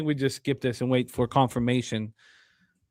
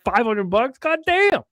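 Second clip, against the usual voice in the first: incredulous exclaim; 9 semitones higher, 5 dB louder than usual. Real, not acted. Incredulous at cohost's story.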